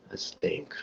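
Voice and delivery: whispered under the breath; whispering. Real, not acted. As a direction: whisper it to yourself. Thinking aloud to himself.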